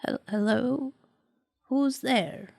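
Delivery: a hint of a frail old woman; lightly voiced frail old lady. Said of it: "in a very old, frail voice".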